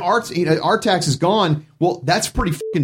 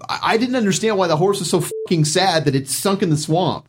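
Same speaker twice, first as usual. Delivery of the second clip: normal talking voice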